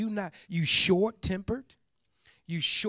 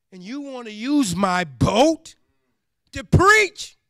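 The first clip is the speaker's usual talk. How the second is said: indignant outburst; 2 semitones higher, 8 dB louder than usual. Retold or imitated. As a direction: burst out in disbelief at the end. Peter's outburst at Jesus.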